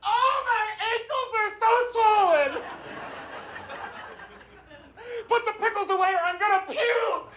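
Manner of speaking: high-pitched wailing kid playing pregnant; नाटकीय गर्भवती बच्ची. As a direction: mimic a child's melodramatic pregnancy complaints, very high. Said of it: "saying things like".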